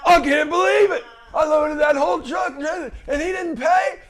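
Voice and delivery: high, loud mock disbelief, nearly shouting; overacted shock at being cheated; fake shocked outrage. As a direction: ham up the outraged innocence. Employee fakes shocked outrage.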